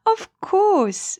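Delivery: high laugh into a bright Of course. Acted. Stella laughs at Bunny's worry about climbing. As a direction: laugh it off warmly. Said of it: "Stella laughed".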